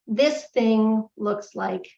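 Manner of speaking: drawn out, deliberate; slow pointed comparison. Shown as a demonstration. Speaker showing the audience a painting beside a photo, slowly. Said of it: "slow demonstrative comparison".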